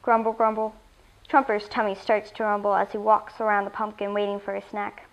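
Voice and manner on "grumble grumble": ordinary delivery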